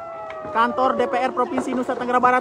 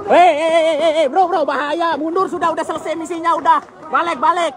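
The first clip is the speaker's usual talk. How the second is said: raised, high urgent calls; urgent calling out; 8 semitones higher than usual. Real, not acted. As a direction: call the guys back from danger. Crowd near fire, warning people. (berseru mendesak)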